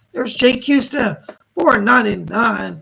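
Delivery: loud, punchy announcement; excited donor shout-out. Real, not acted. Host announces Jake's donation to the stream, excited. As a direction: call out a donor with real gusto.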